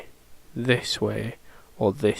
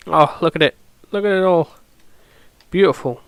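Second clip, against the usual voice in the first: excited; 4 semitones higher, 4 dB louder, faster than usual. Real, not acted. Admiring the jade.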